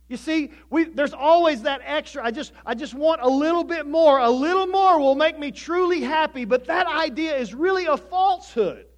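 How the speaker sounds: loud, high-pitched preacher's delivery; impassioned preaching. Real, not acted. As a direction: drive the point home at full voice.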